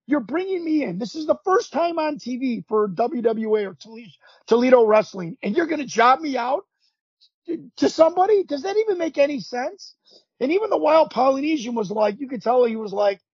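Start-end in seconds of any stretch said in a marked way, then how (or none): 0.1-9.9 higher-pitched, incredulous pushback; indignant protest. Retold or imitated. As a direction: re-enact standing up to the producer. Retold protest against losing debut match.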